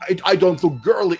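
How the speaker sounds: usual speaking voice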